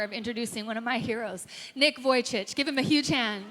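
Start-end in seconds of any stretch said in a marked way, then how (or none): 1.7-3.4 ringing announcement of the guest; announcing loudly. Real, not acted. Host introduces the keynote speaker to students, loud announcing. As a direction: call his name out to the room.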